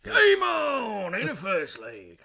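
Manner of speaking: high, loud, slow announcer bellow; darts MC shout. Retold or imitated. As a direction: call it like a darts MC. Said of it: "he shouts".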